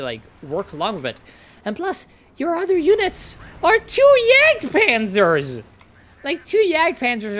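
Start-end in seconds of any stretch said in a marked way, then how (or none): 1.6-7.4 a furious yell at the player's Jagdpanzers; exasperated shout. Real, not acted. Caster yells at the player over two Jagdpanzers, exasperated shout. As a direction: shout it much higher and louder, fed up.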